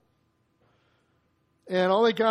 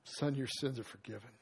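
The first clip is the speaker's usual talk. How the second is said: much softer and lower, almost breathed; hushed tender blessing; 9 semitones lower, 16 dB quieter than usual. Retold or imitated. Preacher savouring Jesus' pardon, hushed and tender. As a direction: let it land gently, like a secret gift.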